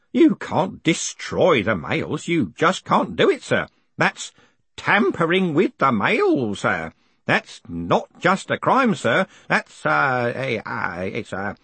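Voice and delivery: put-on high, emphatic old man, faltering at the end; old postal worker, outraged. Grote protests about tampering with the mail, high and scandalised. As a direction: protest in a high old man's voice, groping for the word. Narrator voices agitated Grote.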